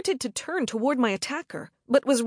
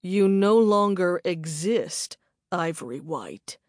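cold menacing man; 4 semitones lower than usual. A narrator's reading. Attacker's cold verdict.

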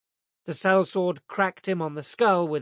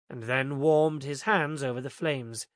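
ordinary delivery

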